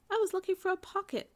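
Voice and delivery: a small bear explaining himself to Lisa; little bear voice. A narrator's reading. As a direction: squeak it like a tiny toy bear.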